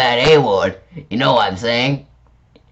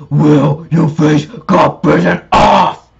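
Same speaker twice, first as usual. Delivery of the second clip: mock scary voice; 4 semitones higher, 5 dB louder, slower than usual. Acting scary.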